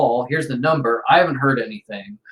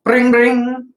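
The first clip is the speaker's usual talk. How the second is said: mock phone ring, higher and louder; 10 semitones higher, 6 dB louder than usual. GM imitates phone ringing.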